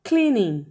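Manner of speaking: "Cleaning" said alone, spaced out; clear English model. Shown as a demonstration. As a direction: model "Cleaning" for the class to repeat. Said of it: "a limpeza se diz cleaning". (pronúncia modelo de "Cleaning" para repetir)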